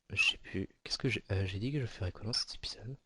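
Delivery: fast whisper to himself; real forgetful whisper to himself; whispered aside. Real, not acted. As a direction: mutter the question under your breath. Muttering to himself, forgot plan.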